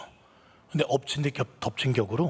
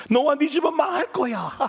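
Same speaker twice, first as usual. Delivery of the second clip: much higher and louder, a screeching demon; shrieking evil voice; 14 semitones higher, 7 dB louder than usual. Acted. Demon voice shrieking doom at the pastor's wife. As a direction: let the dark voice rise into a shriek. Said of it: "이런 소리가 들리더래요".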